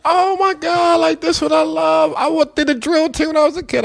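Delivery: high, excited voice of the lady friend; lady friend thrilled about drill team, high and excited. Retold or imitated. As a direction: gush it way up high. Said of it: "She was like".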